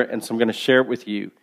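ordinary delivery

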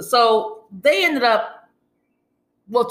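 usual speaking voice

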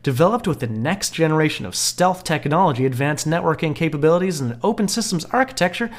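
ad read. Acted. Reads promo facts aloud.

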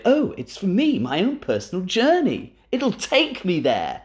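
naive dreamy voice. Retold or imitated. Imitating a naive friend.

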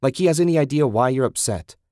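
furious. Acted. Susan furious at Ted.

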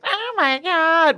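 high, drawn-out annoying nasal whine; Janice from Friends. Retold or imitated. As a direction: stretch it out in an irritating nasally voice. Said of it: "had that annoying nasally".

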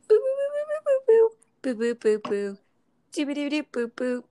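high sung boo boo jingle, fading down; transition sound effect. Acted. Podcast host sings the break transition, high and playful. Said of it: "do the sound effects again for our transition".

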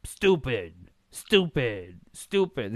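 loud mock taunt, each word on its own; drawn-out, falling jeer; imitating Randy Orton. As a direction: punch the word out as a repeated insult. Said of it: "he's like".

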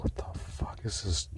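hushed unvoiced whisper; whispered mock reaction. Reacting to her strange jargon, whispered. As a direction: whisper your disbelief. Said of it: "And I'm like".

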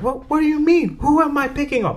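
high-pitched imitation of a teacher's Chinese accent; startled teacher with a put-on Chinese accent; mock Chinese-accented protest, pitched high; harsh Chinese accent. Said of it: "And a very harsh, um, Chinese accent".

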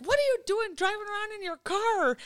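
manic rock star, pitched high; high, excitable mock voice. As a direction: imitate a manic star's high-pitched questioning. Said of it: "He is just as manic in person".

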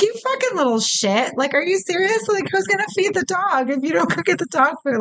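high-pitched, exasperated telling-off; incredulous mock outrage; mock scolding. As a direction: scold him in high disbelief, half-playing it. Voicing outrage at the film's son.

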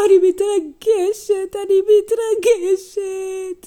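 high, loud, drawn-out wail; overcome grandma. Acted. Grandma overcome at getting her box back, hammed-up wail. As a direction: wail it slowly in mock emotion.

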